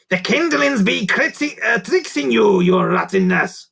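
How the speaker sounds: high, loud creature voice, sly warning; hissing goblin. A narrator's reading. Goblin servant warns the king, hissing creature. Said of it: "Grunt hissed".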